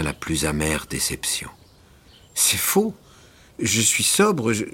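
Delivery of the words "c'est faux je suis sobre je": protesting Élie. A narrator's reading. Élie denying drunkenness to the doctor, high protest. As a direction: deny it in a rush.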